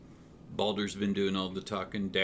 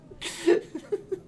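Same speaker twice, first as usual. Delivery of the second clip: squeaky laugh; 4 dB louder than usual. Acted. Mouse cackles after cheer.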